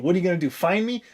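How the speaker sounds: ordinary delivery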